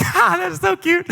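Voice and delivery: put-on high cooing over a cute kid. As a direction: gush at the cute toddler. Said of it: "I'm like".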